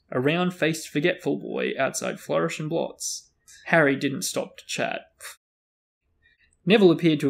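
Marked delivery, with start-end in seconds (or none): none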